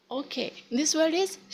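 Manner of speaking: ordinary delivery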